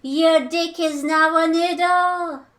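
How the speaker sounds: slow mock incantation; spell casting. Streamer reciting a silly spell slowly. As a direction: cast it like a wizard.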